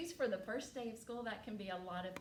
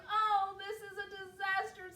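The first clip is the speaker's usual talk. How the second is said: put-on high, loud child's lament; hammed-up dismay, pitched high; despairing little girl; 9 semitones higher, 5 dB louder than usual. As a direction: wail it as a dramatic girl. Girl despairs over lost shoe.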